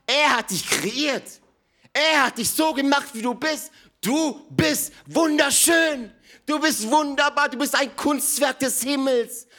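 loud, high, fervent proclamation; soaring sermon, half-shouted; impassioned preaching. Acted. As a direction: proclaim it to the whole hall. Preacher proclaims God's love.

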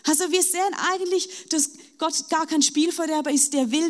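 normal talking voice

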